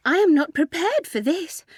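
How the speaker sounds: high-pitched, startled protest; surprised. A narrator's reading. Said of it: "said Ethel in surprise".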